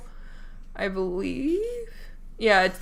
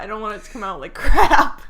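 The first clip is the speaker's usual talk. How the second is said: laughing; 10 dB louder than usual. Real, not acted. Laughing mid-sentence.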